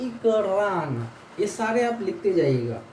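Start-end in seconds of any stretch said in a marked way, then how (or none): 0.0-1.1 इकरान drawn out very slowly, "e-kraan"; teacher stretches इकरान; slow pronunciation demo. Shown as a demonstration. Teacher models the Russian reversed E, slow demo. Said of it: "उल्टा ई है यहाँ पर".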